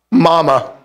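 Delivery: ordinary delivery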